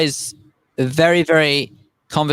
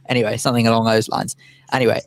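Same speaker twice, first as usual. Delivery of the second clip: ordinary talk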